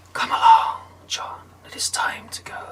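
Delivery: eerie whispered summons; Death's whisper. Acted. Death calling John to go, eerie whisper. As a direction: breathe it out like Death.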